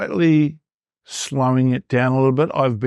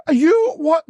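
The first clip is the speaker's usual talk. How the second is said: surprised wife. Retold or imitated.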